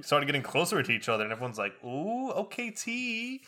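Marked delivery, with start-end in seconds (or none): 1.8-3.4 high, drawn-out mock crowd reaction; pitched-up schoolyard heckle; onlookers' taunting ooh. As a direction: imitate a crowd egging someone on. Onlookers egging on the fight.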